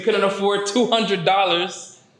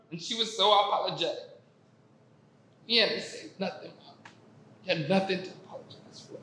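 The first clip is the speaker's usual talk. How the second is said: softer, quick words, nearly overcome; choked up; 2 semitones higher, 8 dB quieter, faster than usual. Real, not acted. Telling how mom apologized for nothing, choked up. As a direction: let the voice drop away, choked with emotion.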